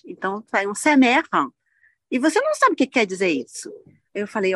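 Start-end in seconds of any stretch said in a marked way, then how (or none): 0.8-1.3 "sa mère", louder and higher, summer read as French; mock the French reading of summer as "sa mère"; French-style mispronunciation. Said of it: "leem a palavra em inglês e falam em francês".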